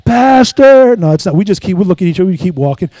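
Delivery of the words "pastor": loud, high call from down the street; drawn-out hailing call. Retold or imitated. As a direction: shout to catch his attention. Same voice calling again.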